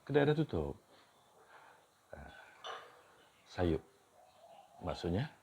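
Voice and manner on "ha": ordinary talk, nothing marked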